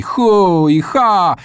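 high, drawn-out war whoops; battle cries. A narrator's reading. Boy stabbing the air with knife and fork, battle cries. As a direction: whoop slowly like a charging corsair. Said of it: "wydając znowu bojowe okrzyki".

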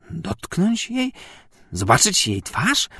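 stammering in horror, drawn out and slow; terrified babble. A narrator's reading. Bentu recoiling from touching the condemned woman, terrified stammer. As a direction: stammer it out as if the thought sickens you. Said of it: "wybełkotał Bentu".

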